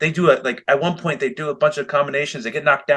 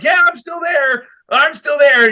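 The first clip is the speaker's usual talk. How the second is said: high, strained imitation of the wrestler in the hold, much higher; mock strained wrestler; 13 semitones higher, 4 dB louder than usual. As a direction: squeeze it out like you're fighting a submission. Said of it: "he's like".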